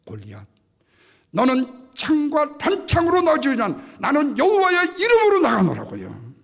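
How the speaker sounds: high, loud, slow battle cry; drawn-out triumphant challenge; David's defiant declaration. Acted. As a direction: proclaim it boldly at the enemy. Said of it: "뭐라고 말하죠?".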